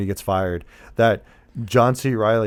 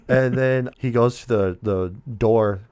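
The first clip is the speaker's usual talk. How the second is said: ordinary talk, nothing marked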